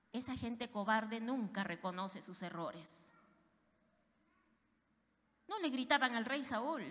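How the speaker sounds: ordinary talk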